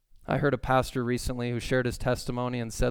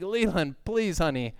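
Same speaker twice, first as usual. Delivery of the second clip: pleading frustration; 6 semitones higher, 3 dB louder than usual. Retold or imitated. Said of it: "And I'm like".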